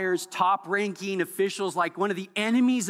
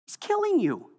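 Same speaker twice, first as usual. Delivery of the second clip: sharply higher, stunned cry; incredulous exclamation; 12 semitones higher than usual. Acted. Preacher stunning the congregation about the enemy, high incredulous cry. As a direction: let disbelief jump the pitch way up.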